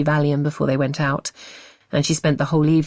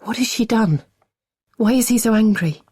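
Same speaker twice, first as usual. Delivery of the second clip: frightened questions; 6 semitones higher than usual. A narrator's reading.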